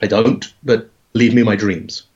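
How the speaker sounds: ordinary talk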